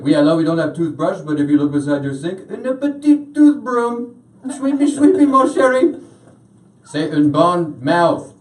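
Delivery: high, exaggerated French-accented English; hammed-up French hotel clerk; mock French accent climbing into a squeaky singsong. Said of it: "the guy on the other line was like".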